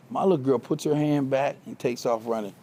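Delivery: ordinary talk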